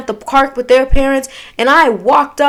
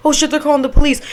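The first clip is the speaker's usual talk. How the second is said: quick, panicky retold worry; mock panicked babble; freaking out; 2 semitones higher, much faster than usual. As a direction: rattle it off in mild panic. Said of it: "I was freaking out because".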